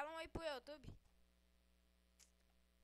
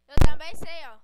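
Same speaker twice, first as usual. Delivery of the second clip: loud boast; 28 dB louder than usual. Acted. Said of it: "eu sei falar português muito bem".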